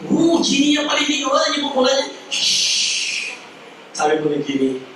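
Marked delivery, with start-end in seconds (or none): 0.1-2.1 genie voice. Acted. 2.3-3.4 genie shush. Acted. Genie hushing in story.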